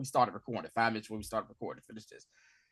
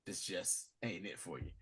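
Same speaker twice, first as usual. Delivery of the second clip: soft, low letting him down easy; quiet advice; 5 semitones lower, 13 dB quieter than usual. Retold or imitated. Boss sending his friend away, soft and low. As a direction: tell him gently to go. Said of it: "Maybe he's gonna tell this dude".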